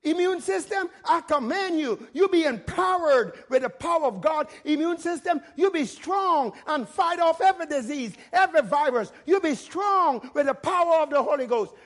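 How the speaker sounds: fervent faith declaration; bold command. Shown as a demonstration. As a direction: order the body to be strong. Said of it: "You command it".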